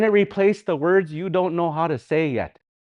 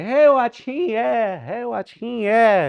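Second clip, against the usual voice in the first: high, lilting singsong; young girls' speech; 7 semitones higher, slower than usual. Acted. Showing how young ladies say it, high singsong. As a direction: imitate young girls with a drawn-out lilt. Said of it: "young ladies, they're gonna say".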